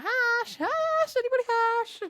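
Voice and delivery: high calling voice. Retold or imitated. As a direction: call out for a lost cat. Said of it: "shouting out".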